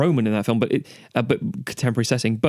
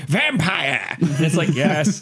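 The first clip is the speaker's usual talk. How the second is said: video game voice actor; 4 semitones higher, much slower than usual. Retold or imitated. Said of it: "kept saying".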